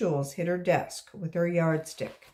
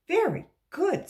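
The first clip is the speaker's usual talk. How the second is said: praise; 11 semitones higher, 6 dB louder than usual. Acted. Teacher praises.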